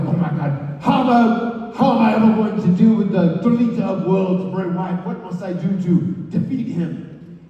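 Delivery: high, loud mock-desperate plea that dissolves into a laugh; hammed-up desperate hero. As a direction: overplay a lost hero begging for help. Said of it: "and I go like".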